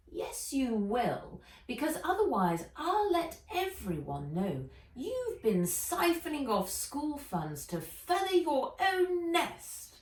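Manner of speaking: twist the knife with a sneer; Ivy, threatening. Acted. Ivy blackmails Limpet.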